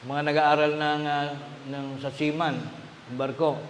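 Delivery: usual speaking voice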